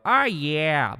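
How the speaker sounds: a light cartoon-dad take on the catchphrase; lightly voiced cartoon "Oh, yeah"; Peter Griffin "Oh yeah". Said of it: "sounds kinda like Peter Griffin".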